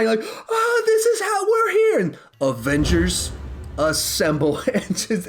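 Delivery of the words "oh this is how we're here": very high, excited exclamation; crying out. Retold or imitated. Theater fans crying out over the reunion, high and excited. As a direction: cry out like a fan overwhelmed. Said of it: "I'm hearing people cry".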